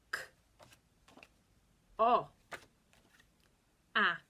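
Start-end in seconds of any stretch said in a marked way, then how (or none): 2.0-2.3 loud heroic shout; superhero voice. Shown as a demonstration. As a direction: call it out like a hero. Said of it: "your best superhero voice".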